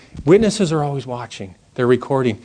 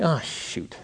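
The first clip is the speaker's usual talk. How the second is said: much softer, sinking realization; dismayed muttering; 13 dB quieter than usual. Retold or imitated. Driver realizing he broke the rule, quietly dismayed. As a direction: mutter it to yourself, caught out.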